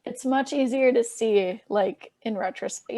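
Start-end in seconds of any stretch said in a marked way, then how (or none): none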